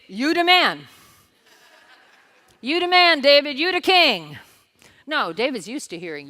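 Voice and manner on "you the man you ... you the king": loud high hype-man cheer; hype praise. Retold or imitated. A flatterer hyping up the king, loud high cheering. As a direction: pump him up like a fan. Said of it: "Nathan does not say to David".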